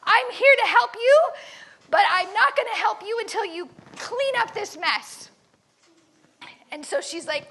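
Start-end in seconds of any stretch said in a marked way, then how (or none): 0.0-5.3 loud, raised voice giving orders, higher in pitch; yelling orders. Retold or imitated. Gladys orders the silent prisoners about, with a firm loud voice. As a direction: call out firmly over the prison yard. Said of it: "she just keeps yelling orders".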